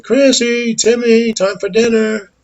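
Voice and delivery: high, sing-song call across the house; mother calling out. Retold or imitated. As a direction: call the kids in for dinner like mom. Mother calling kids to dinner.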